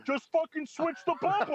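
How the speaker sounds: high, loud frustrated yell with a laugh; yelling at the screen. Retold or imitated. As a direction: shout it at the game, half laughing. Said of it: "I'm just yelling at the screen".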